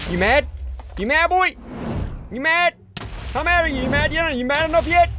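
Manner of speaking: high-pitched goading taunt; egging on an opponent mid-fight. Real, not acted. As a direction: needle them in a high, teasing voice. Taunting creeper while hitting it.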